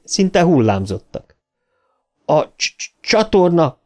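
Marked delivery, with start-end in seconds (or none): 2.2-3.8 stammering urgent. A narrator's reading. Bill names the drain to his friends, stammering. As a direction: stutter on the first sound, pitch up. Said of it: "mondta Bill".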